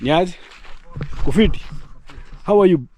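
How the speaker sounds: loud greeting across the yard; calling out. Real, not acted. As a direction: call out to him from afar. Calling out to a neighbour.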